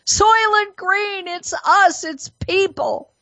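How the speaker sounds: high, slow, drawn-out cry of horror, hammed-up; film quote. Commentator quoting the film's revelation to listeners, hammed-up alarm. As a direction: cry it out like a melodramatic movie line. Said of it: "the fictional movie".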